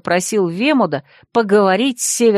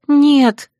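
higher, louder horrified cry; 6 semitones higher, 5 dB louder than usual. Acted. Girl seeing her bloodied hands, horrified cry. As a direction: scream it in horror. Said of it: "вскрикнула я".

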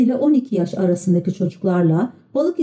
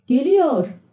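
mild shout; 7 semitones higher than usual. A narrator's reading. Mum fitili announces the coach is coming, mild high-pitched shout. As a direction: call it out high without real volume. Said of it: "diye bağırdı".

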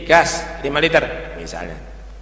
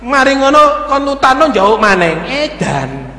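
mock angry outburst; 4 dB louder than usual. Ordinary man's angry reaction mocked.

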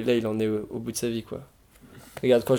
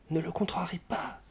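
hushed, much softer, urgent aside; whispered prompt; 7 semitones higher, 7 dB quieter than usual. A narrator's reading. Berlioz warning Ivan behind the professor's back, whispering. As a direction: hiss it under your breath. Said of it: "souffla Berlioz".

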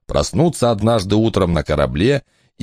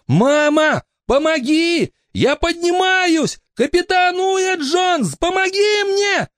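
high, slow pleading yell; panicked cry; slower than usual. Acted. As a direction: call for help as he rises. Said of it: "закричал Барнаби".

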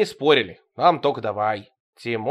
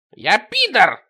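loud, higher shriek of protest; 8 semitones higher, 7 dB louder, slower than usual. Acted. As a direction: squeal it out indignantly. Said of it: "взвизгнул Тимоха".

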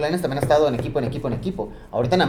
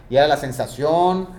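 ordinary delivery

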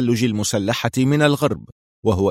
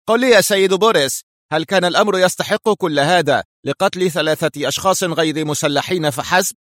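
higher-pitched voice questioning the boss; protesting subordinate, raised pitch; challenging squad leader; 7 semitones higher than usual. A narrator's reading. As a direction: put the question to your superior, pitched up.

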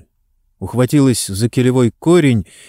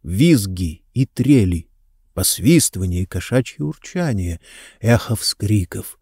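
ordinary delivery

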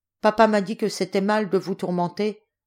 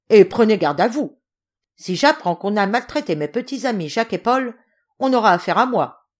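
loud, higher raging threats; furious threats; 3 semitones higher, 6 dB louder than usual. A narrator's reading. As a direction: rage at him and threaten the whole family. General furious at nephew Yégor.

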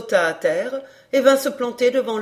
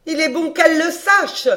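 raised voice; 5 semitones higher, 4 dB louder than usual. A narrator's reading. Countess wanting her maid upstairs to hear, voice raised. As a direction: voice lifted higher to be overheard. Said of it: "en élevant la voix".

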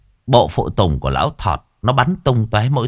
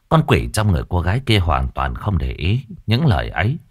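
ordinary delivery, nothing marked

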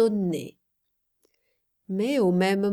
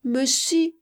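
put-on higher, small child voice; little girl's murmur; 6 semitones higher than usual. A narrator's reading. Child reassuring her big friend, little-girl murmur. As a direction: gently contradict in a raised child's voice. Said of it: "murmura Mayotte".

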